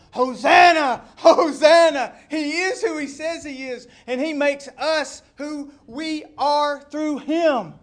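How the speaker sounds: high, full-force proclamation; jubilant praise shout. Acted. Believers in the throng declaring who Jesus is, jubilant shout.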